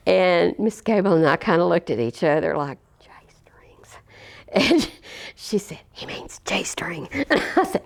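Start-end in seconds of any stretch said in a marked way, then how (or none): 3.0-4.0 hushed incredulous whisper; whispered, stunned at the question; whispered disbelief. Retold or imitated. Teachers react to the odd question. 5.9-7.1 slow whispered correction; whispering the correction to her friend; whispered aside. Retold or imitated. Colleague quietly corrects the word.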